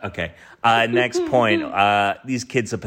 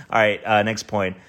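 usual speaking voice

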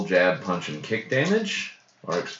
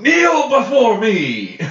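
booming kneel before me; megalomaniac rant; 10 dB louder, slower than usual. Pretend. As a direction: roar it like a conquering tyrant. Player shouts villain line after kill.

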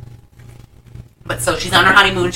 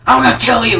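higher, louder imitation of an enraged man; mock angry threat; 6 semitones higher, 5 dB louder than usual. Retelling the man choking the kid, mock angry threat. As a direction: act out a furious movie threat. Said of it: "He's, like, choking him".

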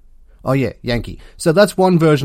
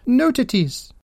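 higher, comic Dutch accent; mock Dutch accent; 11 semitones higher than usual. Shown as a demonstration. Reading out a funny phone setting, mock Dutch accent. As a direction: put on a silly Dutch pronunciation. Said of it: "set your phone's language to Dutch".

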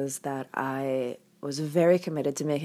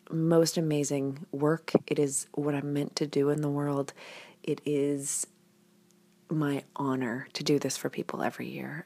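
normal talking voice

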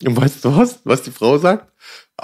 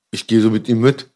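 ordinary talk, nothing marked

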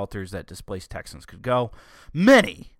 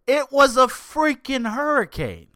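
much higher, louder, slowed, fed-up yell; ranting vlogger losing patience; exasperated outburst; 13 semitones higher, 6 dB louder than usual. Real, not acted. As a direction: blurt it out in disbelief at the obvious. Exasperated rant about politicizing.

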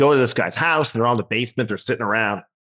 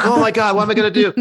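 high, louder fretting; mock panic; 10 semitones higher, 5 dB louder, faster than usual. Comedian dreading the gig, panicking. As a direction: play up helpless panic.

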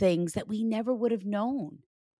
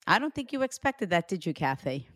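usual speaking voice

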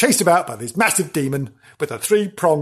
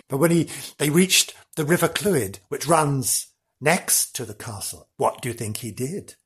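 ordinary talk

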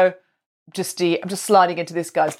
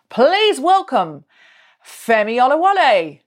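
announcer welcome; 6 semitones higher, 4 dB louder, slower than usual. Real, not acted. Host introduces co-host on stage.

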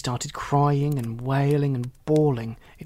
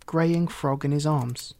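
plain reading voice

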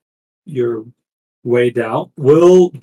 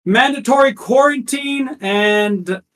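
town crier; 12 semitones higher than usual. Acted. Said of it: "town crier".

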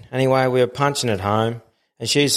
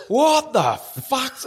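much higher, slow, stretched disbelief; 12 semitones higher, much slower than usual. Retold or imitated. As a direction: drag out the shock as the rocks come. Watching the boat hit headland.

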